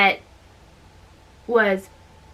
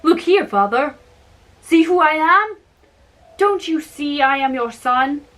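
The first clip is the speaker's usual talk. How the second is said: higher and louder, eager son; eager young giant; 4 semitones higher, 4 dB louder than usual. A narrator's reading. Young giant urging his father to know him, eager. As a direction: call out proudly to be recognised.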